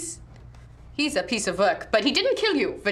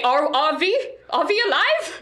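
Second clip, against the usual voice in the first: surprised; 8 semitones higher, 4 dB louder than usual. Acted. Character asks in disbelief.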